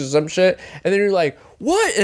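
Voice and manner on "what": high, incredulous 'what'; indignant reaction. Retold or imitated. Friend hearing the parting insult, high indignant reaction. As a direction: fire back in disbelief, pitched up.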